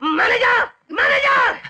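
high, loud, frantic yelling of denial; furious shouting. Acted. Man shouting at the woman and calling the manager, furious. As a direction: scream it like a man who has lost it.

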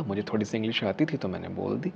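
ordinary talk, nothing marked

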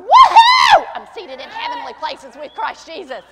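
very high, very loud whoop then shouted claim; triumphant shout. Retold or imitated. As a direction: whoop it out in victory. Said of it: "we'll start going".

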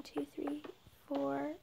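ordinary talk

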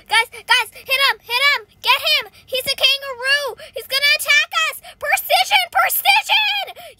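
loud, high, climbing to a squeal; frantic team yelling. Real, not acted. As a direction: scream orders at teammates in alarm. Urging team against kangaroo.

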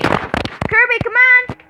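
calling the dog. Real, not acted. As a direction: shout for the dog across the house.

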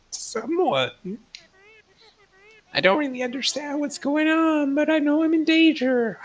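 high, frantic voice of someone in danger; panicked high voice. Acted. Plays panicked caller on phone.

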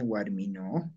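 "Guarmino" said low, final o drawn out; stretched final O. Shown as a demonstration. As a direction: hold the last vowel of "Guarmino". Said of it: "estoy estirando".

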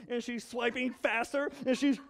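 ordinary delivery

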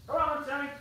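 calling the dog. Real, not acted. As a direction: coax the dog over in a lilting pet voice.

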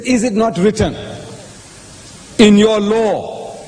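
pointed sarcastic question, slowing at the end. Acted. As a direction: quote it with sarcasm, drawing out the last words. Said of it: "He's sarcastic".

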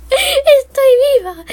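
high, slow relieved gasp; relieved cry. Real, not acted. Gamer surviving, crying out in relief. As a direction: marvel at surviving.

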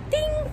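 high sung ding like a chime; mock ding. Making a doorbell sound for her viewers, high ding. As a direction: chime it out like a bell.

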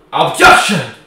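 a sudden loud, high cry of objection; mock courtroom shout. Streamer jokingly yells at his viewers, a loud mock shout. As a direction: shout it like a joke lawyer. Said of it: "Just kidding, just kidding".

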